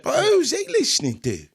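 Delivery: London street accent, put on; mock British accent. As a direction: talk like a British road man. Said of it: "I don't know if I can stop talking like this".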